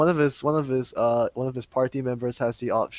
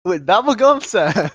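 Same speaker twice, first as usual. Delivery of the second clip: mock announcer; 6 semitones higher, 7 dB louder, slower than usual. Announcing podcast name.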